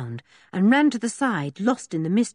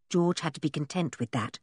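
plain reading voice

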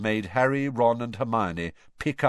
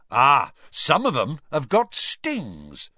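louder and slow, delighted; enthusiastic; 5 semitones higher, 6 dB louder, slower than usual. A narrator's reading. Hagrid explains stings to class, enthusiastic. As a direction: relish the stings with glee. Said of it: "said Hagrid enthusiastically".

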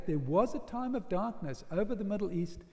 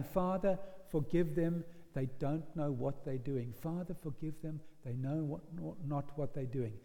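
tender repeated plea; 5 semitones lower, 6 dB quieter than usual. Acted. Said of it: "Jesus kept saying".

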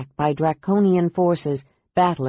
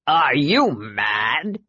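very slow, drawn-out dragon voice; the red dragon raging at Sky; furious dragon roar; 3 semitones lower, much slower than usual. A narrator's reading. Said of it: "the red roared furiously".

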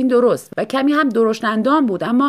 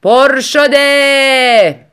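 slow, loud drawn-out yell; husband shouting from the kitchen door; 6 semitones higher, 12 dB louder, much slower than usual. Acted. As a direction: bellow it from the doorway. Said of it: "مرد داد زد".

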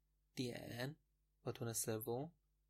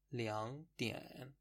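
slow; much slower than usual. Shown as a demonstration.

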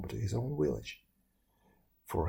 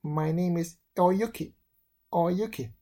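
put-on higher, louder, bright-edged girl voice; young maiden's voice; 6 semitones higher, 5 dB louder than usual. A narrator's reading. Oyuki telling the young man her name. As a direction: sing your name to the stranger.

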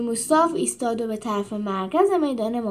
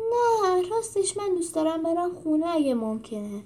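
tearful plea; 6 semitones higher than usual. Acted. Animal pleads to go home.

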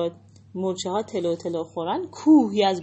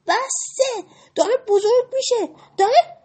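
high, louder cry of alarm; alarmed shout; 8 dB louder than usual. Acted. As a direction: yell a warning as the balloon swells. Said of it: "بقیه فریاد زدن". (فریاد هشدار زیر و بلند)

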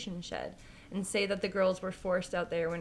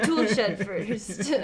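laughing; 5 dB louder, much slower than usual. Real, not acted. Laughs at cohost's joke.

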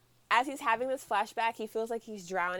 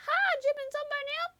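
put-on little boy voice; 4 dB louder than usual. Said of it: "And the boy's like".

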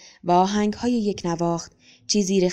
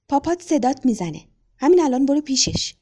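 higher, louder, like a frightened bird; a touch of flustered alarm, pitched up; startled chirping; 7 semitones higher, 3 dB louder than usual. A narrator's reading. Tutor urgently tells the boy his father wants him, flustered and higher.

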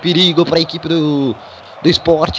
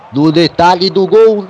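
ordinary talk

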